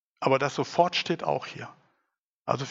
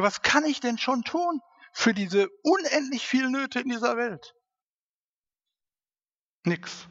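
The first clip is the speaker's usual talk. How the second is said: higher, mock-hopeless question; resigned inner voice; 9 semitones higher than usual. Preacher voicing his own doubting thought, resigned. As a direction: shrug off the world's need as too big. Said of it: "weil ich ja sage".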